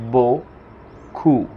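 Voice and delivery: beaucoup said boku, no final consonant sounded; drawn out: beaucoup, bo-ku; silent final letter. Shown as a demonstration. Teacher showing Spanish speakers beaucoup ends on an open ou. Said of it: "la P del final no suena".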